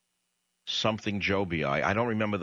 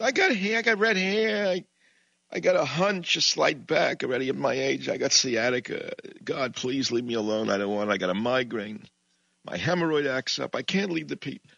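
higher, pleading Brooklyn kvetch; whiny Moses; 5 semitones higher than usual. Acted. Moses begging God to pick someone else, whining. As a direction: whine like a reluctant prophet. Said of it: "That was my Moses bit".

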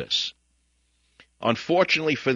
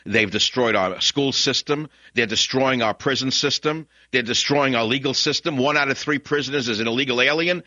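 ordinary talk, nothing marked

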